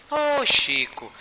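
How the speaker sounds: high, long-drawn fond hello; fond greeting. Retold or imitated. Spirit greeting her old friend Chico, fond greeting. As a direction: stretch the "Ô" high, then glide down.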